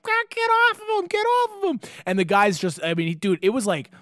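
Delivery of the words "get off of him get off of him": squealed falsetto plea; distressed lady. Retold or imitated. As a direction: imitate a woman screeching in a panic. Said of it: "the poor lady's like".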